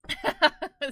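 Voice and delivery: short, high, loud laugh; a quick burst of high laughter. Real, not acted. Laughing at the thought of the cost, high laugh.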